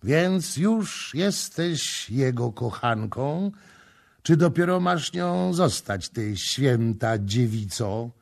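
sneering, bitter and loud, slowing on the last words; bitter mocking challenge. A narrator's reading. Prince accusing the priestess Kama, bitter and scornful. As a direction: throw the accusation at her with a bitter laugh behind it. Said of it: "Następca gorzko roześmiał się".

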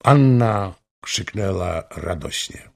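usual speaking voice

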